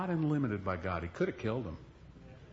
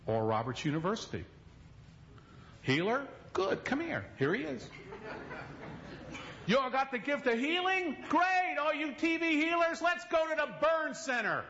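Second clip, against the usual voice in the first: raised, high, insistent preaching; heated retort; 11 semitones higher than usual. Retold or imitated. Pastor to wife, urgent and raised. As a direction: tell Lynette to rush him there. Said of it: "I said".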